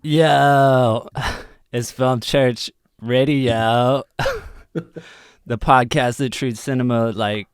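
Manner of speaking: high, loud, dopey stoner drawl with giggles; Justin Long impression. Podcast host opening the show as a celebrity impression. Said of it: "that was my best Justin Long impression".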